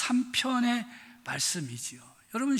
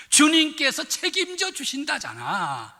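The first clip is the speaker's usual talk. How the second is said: "주님께서 책임져 주신다잖아" said higher and louder, with "주님께서" stressed; emphatic reassurance; 7 semitones higher, 4 dB louder than usual. Shown as a demonstration. Pastor summing up the sermon for the congregation, raising the reassurance. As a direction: hammer home the reassurance. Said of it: "오늘의 말씀을 정리해 봤어요".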